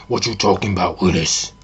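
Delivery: low put-on sitcom man's voice; mock deep character impression; deep catchphrase voice. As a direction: drop down into the deep Willis catchphrase. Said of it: "even when that person said".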